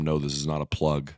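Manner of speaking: normal talking voice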